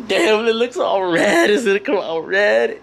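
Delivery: imitated freak-out. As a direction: act out your earlier alarm, stretching the words. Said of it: "I was freaking out 'cause I was like".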